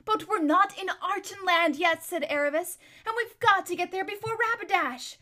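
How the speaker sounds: put-on high, loud insistent girl. A narrator's reading. Aravis insists to Bree they push on. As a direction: insist they must hurry.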